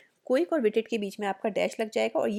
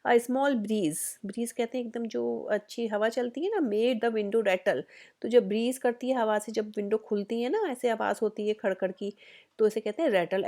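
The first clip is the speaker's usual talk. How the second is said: normal talking voice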